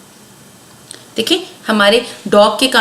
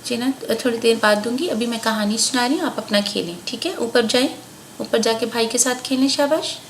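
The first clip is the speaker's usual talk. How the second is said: much softer, quick hushed words; quiet aside; 9 dB quieter than usual. Real, not acted. Mother quietly sends her child upstairs to play.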